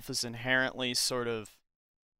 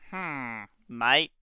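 bad Australian accent; 3 dB louder than usual. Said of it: "really bad impression of an Australian".

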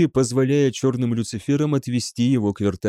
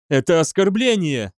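high-pitched outrage, kept in check; mildly outraged exclamation; indignant; 6 semitones higher, 3 dB louder than usual. A narrator's reading. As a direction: protest as if insulted. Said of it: "возмутилась Му".

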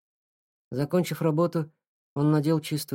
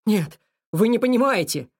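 gasping out the words in fear; halting panicked voice; broken, breathless; 8 semitones higher, 7 dB louder than usual. Acted. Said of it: "прерывисто сказал Микаэль".

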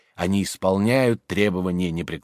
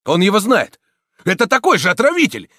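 high, loud mob accusation; crowd shouting; 10 semitones higher, 6 dB louder than usual. A narrator's reading. Voices in the crowd accusing the priest, shouting. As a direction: yell it to the whole crowd. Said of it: "кричали в толпе".

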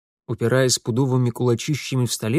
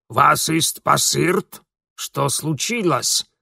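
put-on German accent; 2 semitones higher, slower than usual. Frightened German servant.

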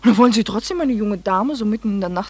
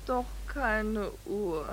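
sleepy; 10 dB quieter, much slower than usual. Acted. Dame antwortet verschlafen.

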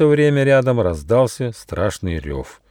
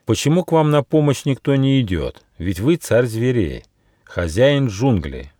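ordinary delivery

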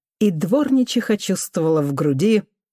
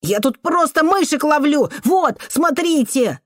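put-on high, plaintive child's voice; desperate pleading; 9 semitones higher than usual. A narrator's reading. Boy explaining the mousetrap to the janitor, pleading. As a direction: plead innocence as a frightened boy.

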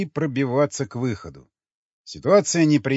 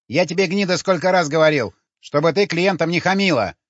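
higher, louder scolding pimp; angry exclamation; 5 semitones higher, 5 dB louder than usual. A narrator's reading. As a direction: scold her in a raised voice. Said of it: "воскликнул он". (сердитый окрик)

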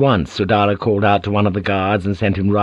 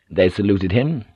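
ordinary delivery

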